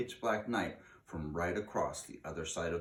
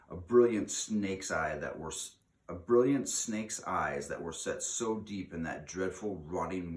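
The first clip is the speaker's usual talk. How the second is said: ordinary delivery, nothing marked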